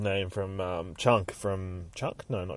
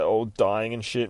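normal talking voice

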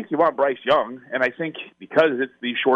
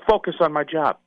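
ordinary delivery

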